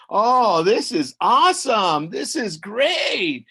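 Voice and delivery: loud, high rave about food; delighted. Acted. Woman tasting her new mole, delighted. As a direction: savour it loudly, stretching the last words.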